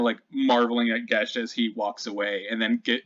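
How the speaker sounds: ordinary talk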